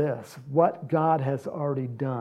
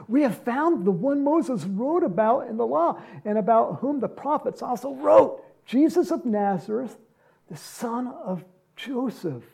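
excited Philip; 6 semitones higher than usual. Retold or imitated. Philip voiced telling Nathaniel.

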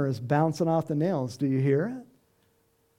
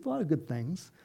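normal talking voice

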